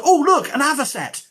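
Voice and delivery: sudden delighted exclamation; light up as if spotting the bird right now; excited. Real, not acted. Academic telling viewers he spotted a rare bird, delighted.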